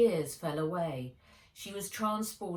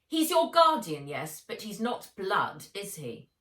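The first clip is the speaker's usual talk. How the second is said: high, loud, prim aunt voice; a cold, superior aunt laying down the law; fast, lofty, domineering aunt; haughty aunt; 4 semitones higher, 7 dB louder than usual. A narrator's reading. Aunt Vera's voice.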